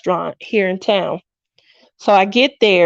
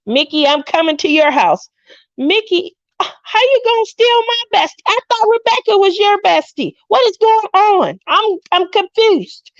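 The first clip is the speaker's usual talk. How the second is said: high-pitched playful protest; mock outrage; 10 semitones higher than usual. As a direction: pretend to be scandalized, voice way up high. Playful quarrel with viewer over bestie.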